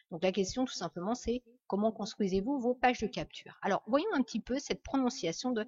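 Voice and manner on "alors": ordinary talk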